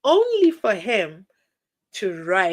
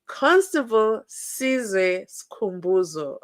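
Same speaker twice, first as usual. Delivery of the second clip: slow, every syllable spelled out; slowly, over enunciating; slower than usual. Shown as a demonstration. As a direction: carefully say each part of the name. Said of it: "Let me say it slowly".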